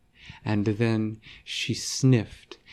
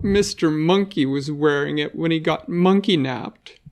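put-on upset little girl; 6 semitones higher, 5 dB louder than usual. Vera sadly answers Mikey.

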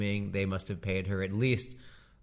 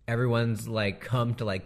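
ordinary talk, nothing marked

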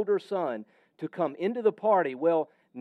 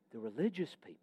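hushed aside; 4 semitones lower, 10 dB quieter, faster than usual. Real, not acted. Preacher drops voice on the religious.

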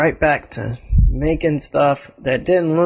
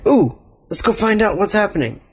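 excited; 7 semitones higher than usual. Acted. Excited character quote.

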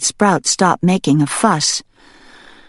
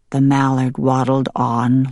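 ordinary delivery, nothing marked

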